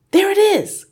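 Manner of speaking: very high, loud exclamation; alarmed cry. A narrator's reading. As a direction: cry out as a startled brother. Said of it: "cried the brother".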